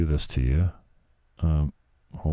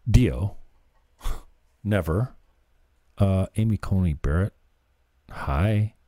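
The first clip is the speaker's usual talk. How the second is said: ordinary delivery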